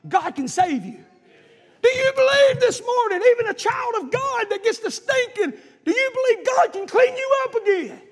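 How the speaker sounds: high, loud revival preaching; full-force preaching. Real, not acted. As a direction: drive each question home to the congregation, shouting at the peak.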